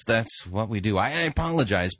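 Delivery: ordinary delivery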